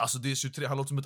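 ordinary delivery

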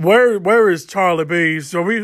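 ordinary talk

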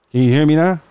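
ordinary talk, nothing marked

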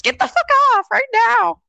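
mock angry mom shriek; yelling mom. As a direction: mimic a mom snapping into a yell. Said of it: "my mom is like".

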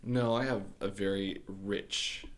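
ordinary delivery

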